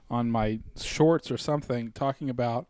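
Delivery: usual speaking voice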